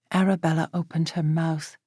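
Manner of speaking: usual speaking voice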